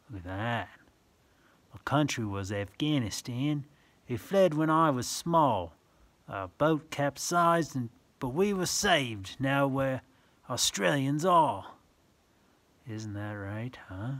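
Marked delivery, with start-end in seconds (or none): 1.7-11.8 sing-song rhyming read-aloud; reading verse to children. Acted. Reading a picture book to a child, sing-song. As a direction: lilt the rhyme for little listeners.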